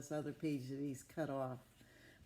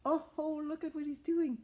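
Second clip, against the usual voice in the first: high and loud, eager; excited storyteller; 11 semitones higher, 7 dB louder than usual. Acted. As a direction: call the kids' attention with delight. Exciting page for children.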